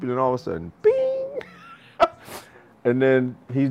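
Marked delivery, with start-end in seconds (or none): 0.8-1.4 high, ringing bing; imitated ding. Coach imitating the sudden moment it clicks, bright ding. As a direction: sing out a bell-like ping.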